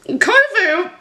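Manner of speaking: giggling through the words, slowed right down; laughing. Real, not acted. Admitting a childhood crush on Kovu to viewers, laughing. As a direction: laugh as you own up to a silly crush.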